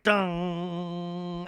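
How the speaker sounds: long sustained "dun" held on one note; imitated dramatic music sting. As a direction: imitate an ominous soundtrack hit. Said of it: "the music is like".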